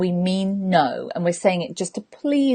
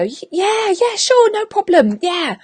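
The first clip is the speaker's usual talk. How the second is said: very high, over-bright agreement; fake eager yes; 12 semitones higher, 4 dB louder than usual. Coach agreeing to help while inwardly refusing, fake eager. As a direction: squeak out a false, obliging yes.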